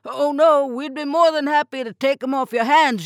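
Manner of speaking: captain hurries to accept the fish, eagerly; eager rush. Acted. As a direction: jump in before the offer's gone. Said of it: "said with a rush".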